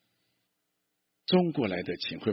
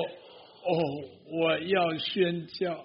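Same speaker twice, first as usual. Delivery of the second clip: mock earnest vow; 6 semitones higher, much slower than usual. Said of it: "然后他说".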